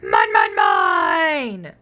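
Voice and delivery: deep, loud dino roar; greedy dinosaur roaring at the class; roaring Minosaur. Acted. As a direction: bellow it at everyone. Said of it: "he roared to one and all".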